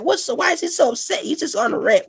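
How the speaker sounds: high, sing-song mock of critics. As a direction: parody the people calling it a rant. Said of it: "people get angry and it's like".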